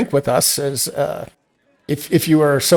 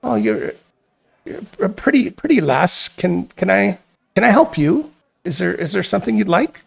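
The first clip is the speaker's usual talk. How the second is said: higher, friendly barmaid voice; a hint of a friendly tavern keeper; Laren the barmaid; 5 semitones higher than usual. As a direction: greet a pretty stranger as a kindly barmaid. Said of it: "and says".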